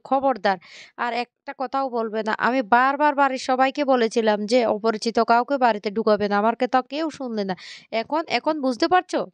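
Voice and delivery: usual speaking voice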